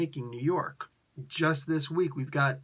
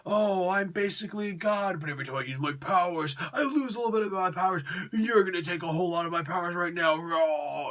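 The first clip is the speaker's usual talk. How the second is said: higher, hurried mock-dope voice; Nathaniel Richards; 4 semitones higher than usual. Reviewer mocking a young hero who explains everything, put-on dopey voice. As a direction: play a clueless kid blurting out his own powers. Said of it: "is an absolute dope in this".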